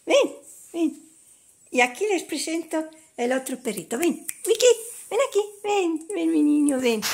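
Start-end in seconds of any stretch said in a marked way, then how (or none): 0.1-1.1 llamada alta y fuerte al perro; llama al perro con voz aguda; llamando al perro. Real, not acted. 4.0-7.0 llamada aguda y fuerte al perrito; llama al perrito con cariño y en voz alta; llamando al perrito. Real, not acted.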